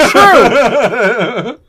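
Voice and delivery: high, loud laughing "true"; laughing agreement. Real, not acted. Conceding a teasing joke from a co-host, laughing hard. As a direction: burst out laughing as you concede.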